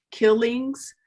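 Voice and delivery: usual speaking voice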